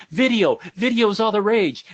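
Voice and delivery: high, eager announcement; imitating someone thrilled by a new trend; excited fan. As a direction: announce it like breaking news. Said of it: "all excited to show me".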